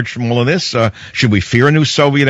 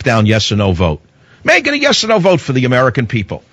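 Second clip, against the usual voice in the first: ordinary talk, nothing marked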